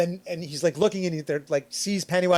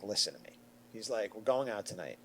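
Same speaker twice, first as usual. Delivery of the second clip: low, hushed parental warning; lowered voice, much softer; stern father; 7 semitones lower, 10 dB quieter than usual. Retold or imitated. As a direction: imitate dad laying down the rules quietly. Voicing father's warning to him.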